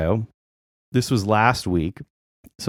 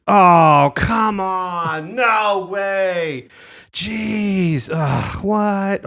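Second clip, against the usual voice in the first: high, whiny groaning complaints; annoyed passengers; 8 semitones higher, much slower than usual. Retold or imitated. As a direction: grumble like fed-up passengers. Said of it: "you hear from all of the passengers".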